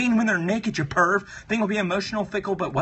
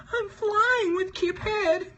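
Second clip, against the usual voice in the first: very high, slow squeaky singsong; flying balloon voice; 13 semitones higher, slower than usual. Acted. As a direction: float it out in a tiny high voice.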